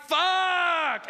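high, panicked outburst; exasperated yell. Acted. Caller panicking at another beep, exasperated high yell. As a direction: yell it out in mock despair.

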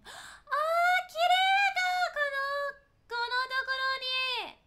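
high, loud squeal of delight; gasp, then cry out in wonder; long-held excited high notes; awed excitement. Real, not acted. Marvels at a beautiful game place.

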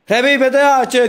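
slow loud call for help; drawn-out shout to a teammate. Real, not acted. Calling teammate to revive him.

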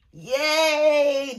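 high excited cheer; little victory yay; happy yay. Real, not acted. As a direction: cheer the win. Celebrating figuring it out.

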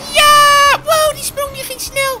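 high, drawn-out squeal of wonder; thrilled whooping. Acted. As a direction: whoop slowly and high in awe. Thrilled by the fast jump.

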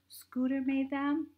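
high, slow, thrilled question; breathless with delight, up high; a little girl's high excited gasp. A narrator's reading.

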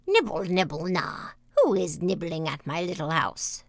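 lightly voiced old witch calling out; falling, sing-song witch's question; soft-voiced witch. A narrator's reading. Old woman calls from the room to whoever nibbles her house, soft-voiced witch. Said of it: "a soft voice cried from the room".

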